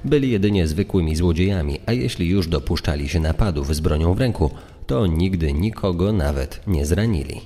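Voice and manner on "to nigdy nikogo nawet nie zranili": usual speaking voice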